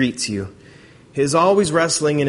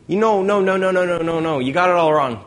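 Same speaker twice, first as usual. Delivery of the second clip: higher, rapid string of dismissive no's; animated mock objection; acted correction; 5 semitones higher, 4 dB louder than usual. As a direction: cut in to correct someone, mildly insistent. Acting Jesus correcting the woman.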